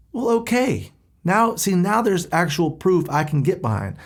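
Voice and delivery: high-pitched, emphatic change of heart; mock sudden conviction. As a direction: react as if suddenly handed proof, pitched well up. Acts out his reaction to rapture.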